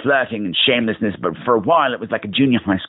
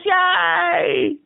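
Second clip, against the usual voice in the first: excited cheer; slower than usual. Real, not acted.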